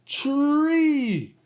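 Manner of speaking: tree said with a firm T then R; clear T R. Shown as a demonstration. Host shows kids the right way. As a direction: stretch out tree so the T R blend is clear. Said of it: "'cause it's T R".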